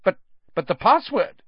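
high, shocked stammering question; stunned stammer. Acted. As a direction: stammer in disbelief.

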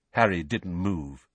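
usual speaking voice

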